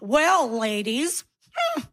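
slow, drawn-out flirty teasing; hammed-up flirt with appreciative hums; mock flirting. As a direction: lay on a slow, patronizing flirt. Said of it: "then they do a flirt".

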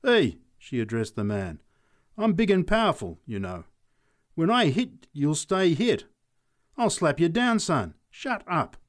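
high, loud bullying threats; big woman laying down the law loudly; aggressive. A narrator's reading. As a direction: square up to him and threaten. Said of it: "This aggressive remark".

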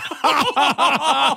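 high burst of laughter; laughing. Real, not acted. Caller laughing at his own office prank story. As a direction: laugh out at the punchline.

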